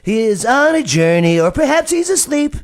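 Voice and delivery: very high, sustained taunting voice; mocking Elijah. Elijah ridiculing a god who won't answer, high mocking voice. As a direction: jeer in a put-on high pitch. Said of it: "mocked them, saying".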